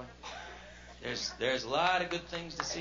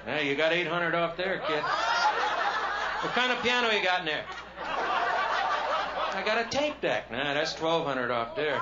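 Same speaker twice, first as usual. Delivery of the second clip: smug salesman deducting; 2 semitones higher, 4 dB louder, slower than usual. Retold or imitated. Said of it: "salesman deducting for options".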